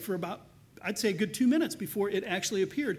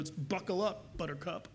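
mock dismissive, softer and dropping away; dismissive brush-off; 6 dB quieter than usual. Preacher mocking a cold church member, dismissive and soft. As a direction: toss it off like an eye-roll. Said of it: "click your tongue and roll your eyes and go".

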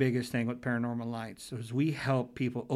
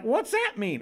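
put-on curious little girl; 9 semitones higher, 3 dB louder than usual. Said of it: "She's just like".

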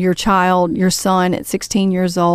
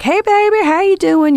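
put-on old man's greeting; 12 semitones higher, 4 dB louder than usual. Old concierge greeting a guest, high and loud. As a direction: greet her like a charming Southern old-timer.

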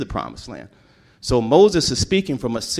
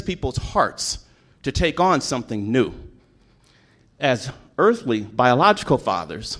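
rising, emphatic preacher's voice; preaching; 5 semitones higher, 4 dB louder than usual. Acted. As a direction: preach it to the congregation with conviction. Sermon builds to preaching.

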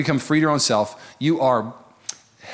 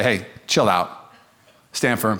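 calm reassurance; 5 semitones lower, slower than usual. Retold or imitated. Calming panicking people.

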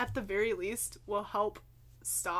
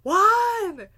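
much higher, loud, slow mock yell of a mother; mom yelling; 12 semitones higher, 16 dB louder, much slower than usual. Son imitating his mom yelling from the kitchen. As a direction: holler it like a mom at the stove. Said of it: "I can hear my mom now yelling at me".

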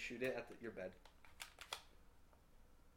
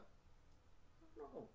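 squeaky whine; 11 dB quieter, much slower than usual. Acted. Mock whine while being pestered.

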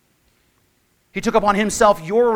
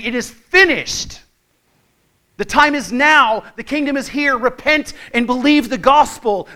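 proclaiming Jesus; 6 semitones higher, 8 dB louder than usual. Retold or imitated. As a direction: announce it with full authority. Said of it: "he's standing out in the street saying".

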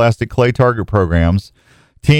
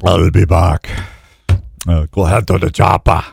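lower, lightly done Arnold voice; Arnold impression; 6 semitones lower than usual. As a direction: attempt Arnold again, not quite there. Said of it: "I still, I can't do it".

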